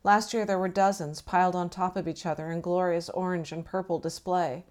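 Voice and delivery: ordinary delivery, nothing marked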